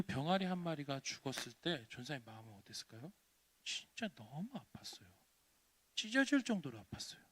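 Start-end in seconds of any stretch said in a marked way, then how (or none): 3.6-5.1 hushed whispered sorrow. Acted. Preacher telling children her heartbreak, whispered. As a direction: breathe the pain quietly.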